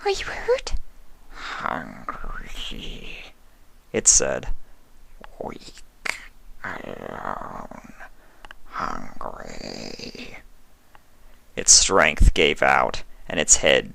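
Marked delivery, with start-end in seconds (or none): 0.0-0.7 high, breathy whisper of a timid pony; gentle, shy, barely daring to ask; Fluttershy's voice. A narrator's reading. 1.3-10.4 low, softer, rasping whisper; a starving creature barely able to speak; weak changeling. A narrator's reading.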